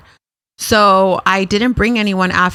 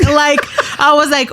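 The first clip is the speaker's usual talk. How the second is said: usual speaking voice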